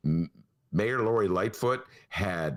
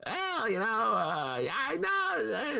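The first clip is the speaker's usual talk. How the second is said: a hint of a mild, hesitant politician; Ken Davis imitation; 13 semitones higher, much slower than usual. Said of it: "my Ken Davis imitation".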